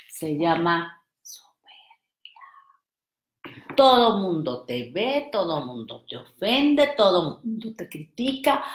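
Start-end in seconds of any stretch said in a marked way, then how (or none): 0.1-2.4 slow hushed whisper, said in confidence. Shown as a demonstration. Whispering a secret to the audience, hushed. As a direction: lean in and breathe it. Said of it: "¿Me acerco? Aquí sí puedo".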